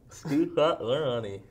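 slow, mushy round a mouth guard; mouth guard speech. Real, not acted. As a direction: talk with the guard in.

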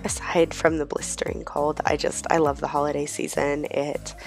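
normal talking voice

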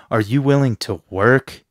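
"work" stressed and rising; emphasis on work. Acted. Trainer challenging God to commit, stress on "work". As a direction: ask it leaning hard on the final word "work". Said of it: "with emphasis on the wor-, work".

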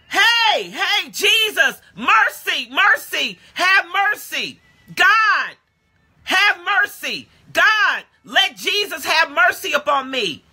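high, drawn-out pleading cries; yelling out. Acted. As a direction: yell to Jesus for mercy like the beggar. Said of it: "he starts yelling out".

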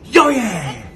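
high, loud hype shout with a laugh; excited. Acted. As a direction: pump the baby up. Hyping the baby up.

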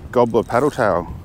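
normal talking voice

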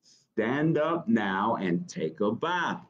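plain reading voice

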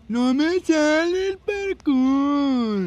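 grito agudo, fuerte y muy alargado; quéjate gritando, estirando cada vocal; lamento a gritos. Pretend. Falla el parkour jugando. (lamento exagerado a todo volumen)

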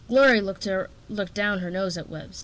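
plain reading voice

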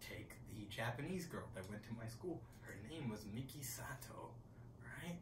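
unvoiced, conspiratorial whisper. Real, not acted. Comedian whispering to the crowd about a schoolmate, hushed confiding. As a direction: lean in and whisper it like a secret.

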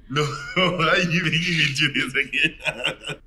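laughing protest, high and slow. Real, not acted. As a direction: whine through the laughter. Friend urges another tasting.

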